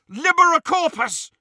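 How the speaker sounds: high, loud yell; yelled spell. A narrator's reading. Harry casting as the goblin howls, high full yell. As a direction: yell the spell out, pitch shooting up. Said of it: "yelled Harry".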